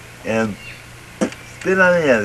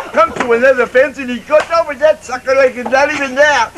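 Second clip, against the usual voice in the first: ordinary delivery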